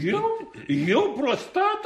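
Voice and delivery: very high, drawn-out protest; indignant disbelief. Acted. Clown denies having prostate trouble, indignant. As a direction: squeal back the accusation, scandalized.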